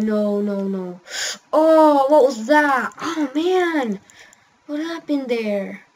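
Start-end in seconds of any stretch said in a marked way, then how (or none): none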